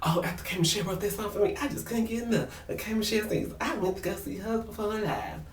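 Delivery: high, soft, gushing imitation; Mimi. Reality star raving about a singer, mock gushing.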